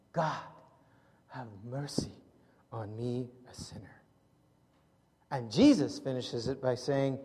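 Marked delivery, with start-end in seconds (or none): 0.1-4.0 soft, low and fading to a whisper; humble plea. Retold or imitated. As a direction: pray it with head bowed in shame. Tax collector, head down, pleading.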